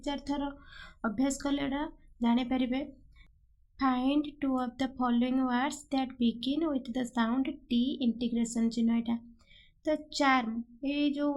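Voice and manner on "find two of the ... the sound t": very slow, word by word; slow question read. Shown as a demonstration. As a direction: dictate the exam question slowly. Teacher reads exam question aloud.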